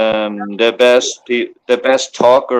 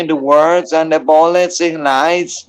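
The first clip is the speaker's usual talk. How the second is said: a mock-solemn Irish blessing, pitched a bit higher; put-on Irish accent; 4 semitones higher than usual. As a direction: intone it like a hammed-up Irish elder. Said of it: "I tried to make up a good accent".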